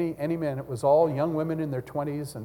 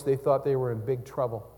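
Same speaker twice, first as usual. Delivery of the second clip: usual speaking voice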